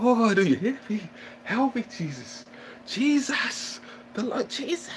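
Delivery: high, hammed-up cry to the Lord; mock prayer plea. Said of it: "I call Jesus like never before".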